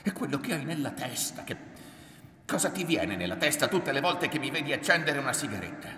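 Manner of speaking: fast, high, loud rant building in heat; worked-up, resentful tirade; angry accusation. A narrator's reading. Son rants accusingly at mother.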